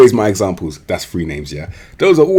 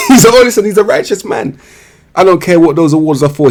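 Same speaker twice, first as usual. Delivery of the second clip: high, louder through laughter; laughing; 8 semitones higher, 5 dB louder than usual. Real, not acted. Praising an honest player while cracking up. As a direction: repeat it cracking up. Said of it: "repeats it laughing".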